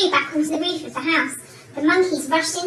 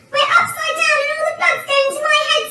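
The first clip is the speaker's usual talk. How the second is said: high, loud panicked wail; screamed; 12 semitones higher, 7 dB louder than usual. A narrator's reading. Mister Twit fearing the blood rushing down, screamed. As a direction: scream it in alarm. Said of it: "screamed Mister Twit".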